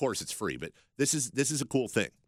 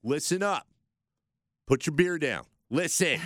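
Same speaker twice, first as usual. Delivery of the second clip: loud call-out; 6 semitones higher, 5 dB louder than usual. Acted. Calling out to Raider fans.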